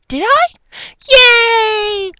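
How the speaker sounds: very high, loud squeal; joyful exclamation. A narrator's reading. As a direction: squeal it, stretching the cheer. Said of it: "another lo-, long, joyful exclamation".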